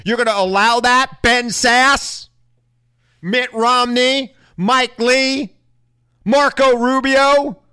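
building to a high, loud indignant shout; furious rant. Real, not acted. Pundit berating Republican senators, rising to a furious shout.

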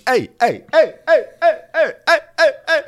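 very high, loud repeated yelps; mock shouting to intervene. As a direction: imitate someone calling out over a fight. Chris trying to interrupt the fight.

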